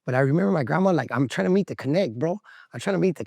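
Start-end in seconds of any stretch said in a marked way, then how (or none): none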